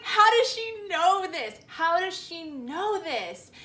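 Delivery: high, loud retold panic; frantic disbelief. Girl reacting to her mom's second text, in acted panic. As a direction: exclaim it in alarmed bafflement, stretching the repeat.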